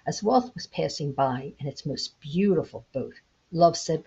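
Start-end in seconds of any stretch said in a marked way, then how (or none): none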